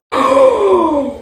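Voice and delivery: sharp high gasp; shocked gasp. Real, not acted. Girlfriend spotting a photo in the chat, gasping. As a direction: gasp at what you see.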